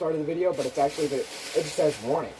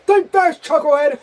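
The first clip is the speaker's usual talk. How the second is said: loud, high taunting yell; shouted taunt; 9 semitones higher, 10 dB louder than usual. Acted. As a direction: yell it out to mock someone. Shouted game quote.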